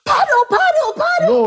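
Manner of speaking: high frantic yelling of paddle; mock panicked shout. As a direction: shout it like you're fleeing for your life. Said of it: "and then he said".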